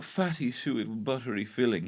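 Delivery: soft, lightly voiced creepy gourmand; Hannibal Lecter. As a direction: savour the food quietly and creepily. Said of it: "he's gone full Hannibal Lecter".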